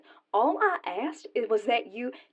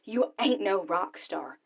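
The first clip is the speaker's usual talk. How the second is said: ordinary talk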